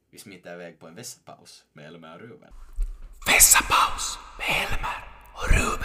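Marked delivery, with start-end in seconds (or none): 3.3-5.8 breathy whispered jingle title; a secretive announcer's whisper. Acted. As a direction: whisper the segment name like a show sting.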